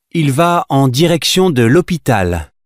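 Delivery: ordinary talk, nothing marked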